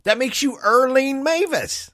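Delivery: teasing falsetto, slowed; mock high voice. [name] teasing about titles in a high mock voice.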